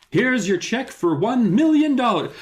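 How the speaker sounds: high, overblown make-believe delivery; mock childlike storytelling. Mocking the check scene as a child would tell it, childlike. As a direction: play it like a kid describing a big deal. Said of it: "kind of feels like how a child would describe this".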